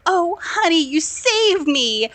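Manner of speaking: high, drawn-out mock gratitude; gushing wife. Wife thanks husband for saving her, mock-gushing. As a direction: swoon over your hero, hammed up.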